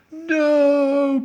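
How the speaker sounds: very high, loud drawn-out no; anguished long shout; crying out. A narrator's reading. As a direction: wail it in despair. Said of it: "cried Edmund".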